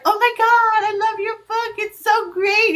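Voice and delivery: high, gushing mock fan. Reader telling the author they love her book, gushing high. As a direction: gush over the book like a fan. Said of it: "when people are like".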